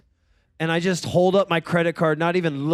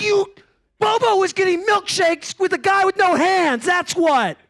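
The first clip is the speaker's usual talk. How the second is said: high-pitched, exasperated outburst; mad; 12 semitones higher, 4 dB louder than usual. Retold or imitated. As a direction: rant indignantly at the niece, acting it out. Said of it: "Why are you getting so mad".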